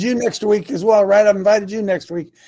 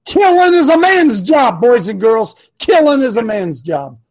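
emphatic declaration; 3 semitones higher, 6 dB louder, slower than usual. Real, not acted. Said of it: "preaching point".

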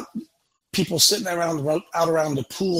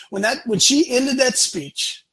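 ordinary talk

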